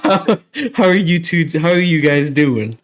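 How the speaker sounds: asked through a laugh. Shown as a demonstration. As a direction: try to ask without cracking up. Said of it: "ask the question again".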